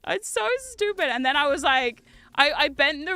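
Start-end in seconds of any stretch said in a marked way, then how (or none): 0.1-1.1 higher, exasperated cry; high exclaim. Real, not acted.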